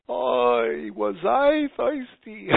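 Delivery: high, slow New York sigh of relief, stretched out; punchline kvetch in a New York drawl; New York accent. Retold or imitated. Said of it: "what does he say".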